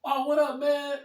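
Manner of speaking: high, upbeat acted hello; eager, high-pitched mock greeting; friendly greeting. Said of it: "Not like".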